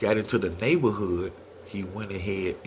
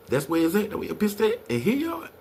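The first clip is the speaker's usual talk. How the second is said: rising alarm; 11 semitones higher than usual. Retold or imitated. Uncle spotting police at the hiding spot, rising alarm. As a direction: voice climbing higher and higher. Panic seeing police at the house.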